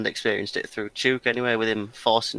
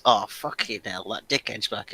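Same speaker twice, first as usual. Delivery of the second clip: low, soft, slow grumble; grumbling mum; 4 semitones lower, 8 dB quieter than usual. Retold or imitated. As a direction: mutter it like a fed-up mum. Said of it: "Josh's mum says".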